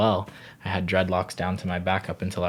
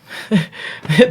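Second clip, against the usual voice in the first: sudden high burst of laughter; a short laugh that jumps up high; 10 semitones higher, 8 dB louder than usual. Real, not acted. Laughing while listing her favorite reggae artists, a high laugh.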